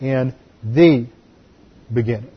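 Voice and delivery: "the" pronounced thee, then a pause; stress on THE. Shown as a demonstration. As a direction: hold out "the" before "beginning". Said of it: "the noun would be definite".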